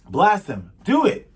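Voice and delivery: loud command jumping high at the end; urgent command. Acted. Pirates order the cannon fired, loud urgent command.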